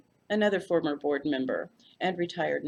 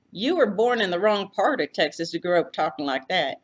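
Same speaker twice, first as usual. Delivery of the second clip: Texas hostess; 6 dB louder than usual. A narrator's reading. Floy greeting her uniformed guest, gushing Texas hostess. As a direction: fuss over the guest like a Texas aunt. Floy's voice.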